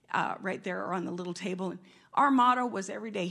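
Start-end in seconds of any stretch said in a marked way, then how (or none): none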